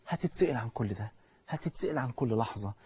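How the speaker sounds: very low and soft; hushed warning. Real, not acted. Preacher warning you of being questioned, hushed. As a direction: drop right down to a grave hush.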